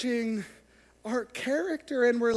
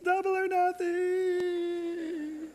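slow ominous echo; 9 semitones higher, much slower than usual. Acted.

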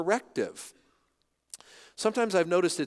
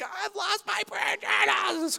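freaking out; 3 dB quieter than usual. Retold or imitated. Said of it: "I was freaking out".

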